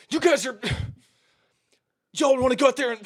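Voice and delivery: high, loud, sputtering between sighs; too angry to finish the sentence; furious coach. Retold or imitated. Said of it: "as pissed off as I've ever seen".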